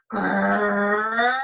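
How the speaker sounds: loud monster growl. Acted. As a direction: growl like a beast in capitals. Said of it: "required all capitals and rather large punctuation".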